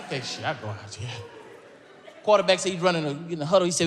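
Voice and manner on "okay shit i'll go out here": resigned mutter. Retold or imitated. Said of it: "I said".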